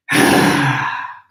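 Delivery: loud, breathy sigh with no voice in it; big releasing sigh. Shown as a demonstration. Teacher releasing unwanted energy with the class, big sigh. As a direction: exhale hard and let it all go. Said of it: "exhaled and released with this big sigh".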